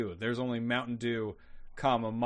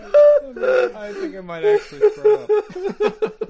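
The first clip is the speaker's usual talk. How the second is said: laughing; 10 dB louder, much slower than usual. Real, not acted. Players joking about fan convention.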